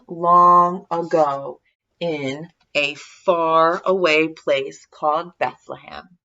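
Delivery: ordinary delivery, nothing marked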